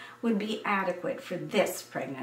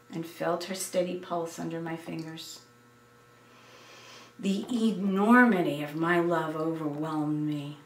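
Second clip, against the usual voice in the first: plain reading voice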